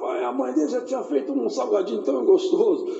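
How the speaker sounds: normal talking voice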